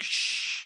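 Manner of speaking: long soft shushing noise; hushing shh. Acted. Dinosaur sneaking through forest, playful hush. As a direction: shush them like a sneaking creature. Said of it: "He's like".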